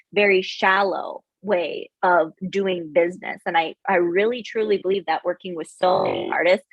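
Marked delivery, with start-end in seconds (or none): none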